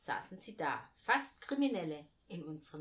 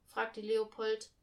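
ordinary delivery

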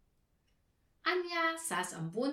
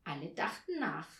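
ordinary delivery

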